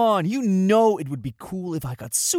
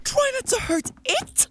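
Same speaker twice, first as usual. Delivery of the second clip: incredulous. Acted. Incredulous echo of her.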